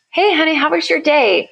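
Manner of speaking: high, singsong hello to a husband; cheery spouse greeting. Acted. Wife walks in and greets her husband, cheerily. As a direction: greet your partner with a lifted, cheery voice.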